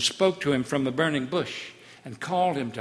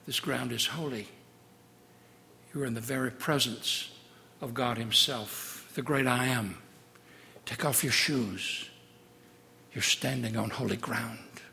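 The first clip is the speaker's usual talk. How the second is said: hushed sermon close; 3 semitones lower, 8 dB quieter than usual. Acted. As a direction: draw the congregation into awe quietly. Hushed closing exhortation.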